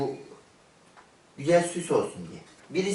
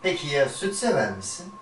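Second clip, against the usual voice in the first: ad voiceover; 3 semitones lower than usual. Acted. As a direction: sell the banana milk like a TV ad. Parody milk ad.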